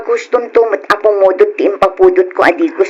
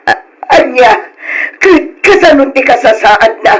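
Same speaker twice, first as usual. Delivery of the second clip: shocked stammer. Acted. Mother asking about Arman's condition, stammering through tears. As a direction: stumble over the words in shock.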